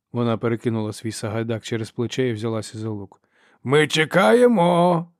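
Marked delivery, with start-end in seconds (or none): 3.6-5.1 high, loud, drawn-out taunt; singsong. A narrator's reading. Brother taunts hidden Ferro in a singsong. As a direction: sing it out to someone hiding. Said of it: "проспівав він".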